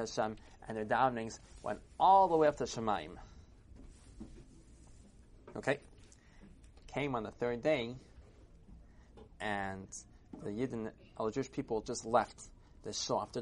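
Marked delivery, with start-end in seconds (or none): none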